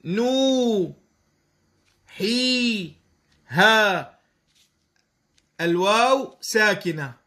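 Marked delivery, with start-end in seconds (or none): 0.1-4.2 نو حي ها مقطعًا مقطعًا مع مد; قطّع نوحيها وامدد كل مقطع; تقطيع الكلمة. Shown as a demonstration. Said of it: "شرطان في الحرف ذاته". (تقطيع نوحيها بالمد)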